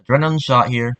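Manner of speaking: voice line recording. Shown as a demonstration. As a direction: call it out like a game voice line. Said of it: "and record them".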